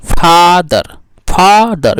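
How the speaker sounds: फादर broken in two, फा-दर; split into syllables. Shown as a demonstration. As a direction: pause between फा and दर in फादर. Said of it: "बीच में रुकावट आ रहा है कि नहीं". (फादर को रुककर फा-दर बोलना)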